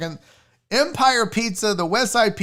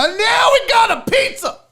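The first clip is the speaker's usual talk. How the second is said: high, triumphant yell; laughing, hollered delight; excited shout; 13 semitones higher, 5 dB louder than usual. Real, not acted. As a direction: celebrate at full voice. Finally sees a pizza he likes.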